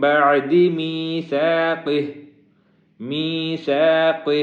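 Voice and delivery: slow, careful ثا in میثاقہ; thin ثا clearly set apart from سین; light tha. Shown as a demonstration. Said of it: "سا، اس کو باریک پڑھتے ہیں".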